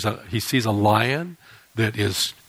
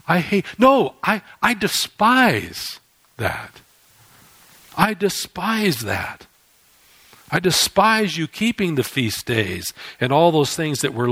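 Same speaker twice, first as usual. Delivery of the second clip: indignant God; 4 semitones higher than usual. Acted. God rejecting Israel's feasts: slow and disgusted. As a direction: draw out "despise" like an offended God.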